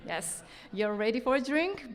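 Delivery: ordinary talk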